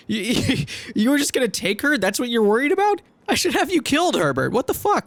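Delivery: high, incredulous outrage; outraged. Acted. Hero outraged that the cabin boy would take her. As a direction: rise into furious disbelief.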